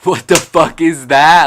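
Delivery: loud, high exclamation, drawn out; incredulous outburst. Real, not acted. Vlogger mocking her old crybaby habit, incredulous outburst. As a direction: blurt it out loud in disbelief at yourself.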